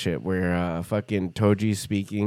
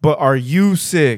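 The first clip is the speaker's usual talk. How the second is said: slow, loud, pushy asking; slow, louder insistent ask; slow insistent question; 5 semitones higher, 8 dB louder, slower than usual. Retold or imitated. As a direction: drag it out and press hard. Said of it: "insisting again".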